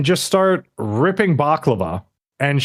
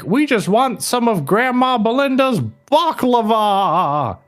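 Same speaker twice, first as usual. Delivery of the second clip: hammed-up thug plea; 9 semitones higher than usual. Host voicing the thug asking for baklava, hammed-up. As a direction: stretch it out big and high, mock-dramatic.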